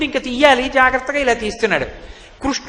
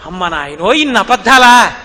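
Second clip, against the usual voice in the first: louder, drawn-out 'ఇన్ని అబద్ధాలా'; aghast outcry; 3 semitones lower, 6 dB louder than usual. Acted. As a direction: cry out in shocked disbelief. Gopi aghast at lies.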